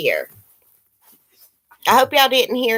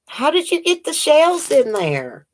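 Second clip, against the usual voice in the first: amazed; 6 semitones higher than usual. Real, not acted. Praising Shirley's handmade shells, higher amazed gushing. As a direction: marvel at it.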